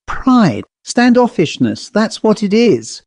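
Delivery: usual speaking voice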